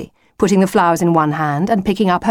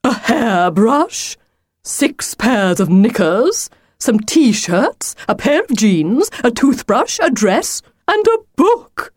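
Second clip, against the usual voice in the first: higher-pitched disbelieving list, climbing to the end; dismayed exclaiming; 6 semitones higher, slower than usual. A narrator's reading. Wolf finding no lunch in the bag, dismayed. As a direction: list each item with growing dismay. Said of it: "the wolf exclaimed in dismay".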